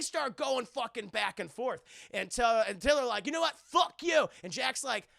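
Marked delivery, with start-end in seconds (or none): 3.3-4.3 high, loud retort; mock angry outburst. The two arguing back and forth, mock-furious. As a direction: imitate a quarrel boiling over. Retold argument escalates.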